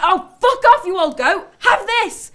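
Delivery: high, loud, furious yell; losing it. Acted. Walker pelting the old bloke with bread, losing it completely. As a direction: lash out at full pitch and volume. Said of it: "then I just lost it".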